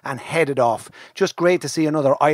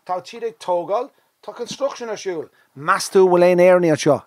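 presenter to camera; 8 semitones higher than usual. Acted. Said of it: "I said to camera".

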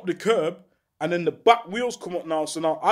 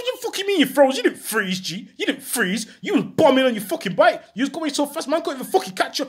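angry telling-off; 8 semitones higher than usual. Retold or imitated. Scolding friend who hid.

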